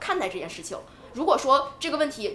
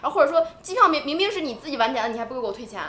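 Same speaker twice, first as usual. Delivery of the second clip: heated phone complaint, raised pitch; mock indignant griping, pushed higher and louder; retold angry complaint; 4 semitones higher, 5 dB louder than usual. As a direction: reel off grievances at customer service, worked up. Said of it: "非常地生气".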